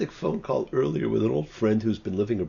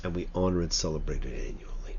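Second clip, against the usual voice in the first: hushed; 9 semitones lower, 5 dB quieter, faster than usual. Real, not acted. Sermon, hushed aside.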